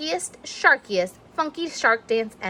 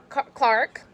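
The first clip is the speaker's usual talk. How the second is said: ordinary delivery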